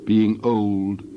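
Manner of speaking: slow, fading final words; solemn closing. A narrator's reading. Narrator closing Job's story, slow and fading.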